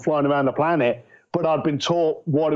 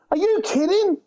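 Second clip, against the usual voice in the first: much higher, incredulous; disbelief. Retold or imitated. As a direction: act stunned disbelief. Retold disbelief at father.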